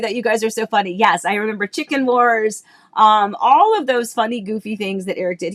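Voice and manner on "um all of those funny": ordinary delivery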